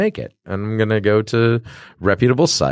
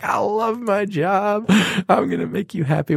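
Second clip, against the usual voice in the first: mock gushing delight; 11 semitones higher than usual. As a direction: gush in a very high, playful voice. Acted delight at lovely customer.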